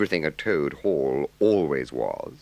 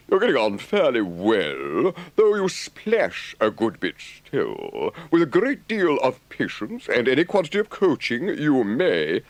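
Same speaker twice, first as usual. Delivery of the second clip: Rat's voice; 10 semitones higher, 6 dB louder than usual. A narrator's reading. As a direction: needle Toad gently about his rowing. Rat teasing Toad.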